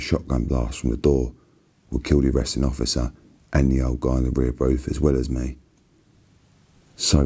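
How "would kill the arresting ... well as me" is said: ordinary delivery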